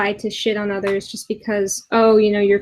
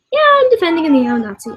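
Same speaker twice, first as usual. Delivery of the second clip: sarcastic mock voice, higher and quicker; mocking quote; 7 semitones higher, 5 dB louder, faster than usual. Vlogger mocking the guy defending a neo-Nazi, sarcastic. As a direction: sneer it out like his lame excuse.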